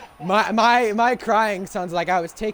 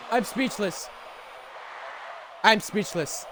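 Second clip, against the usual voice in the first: dumbfounded; 4 semitones lower, 6 dB quieter than usual. Real, not acted.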